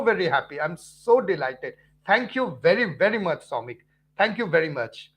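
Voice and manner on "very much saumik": ordinary talk, nothing marked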